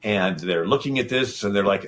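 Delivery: ordinary talk, nothing marked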